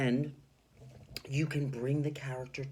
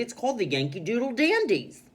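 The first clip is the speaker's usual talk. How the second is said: high and loud, thrilled; excited; 10 semitones higher, 8 dB louder than usual. Shown as a demonstration. Teacher voicing excited Missus Teaberry announcing the team name. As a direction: announce it proudly. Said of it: "Missus Teaberry got excited".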